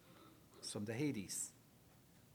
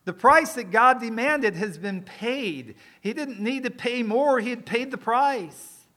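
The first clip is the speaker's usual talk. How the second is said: full-force preaching; 7 semitones higher, 10 dB louder than usual. Acted.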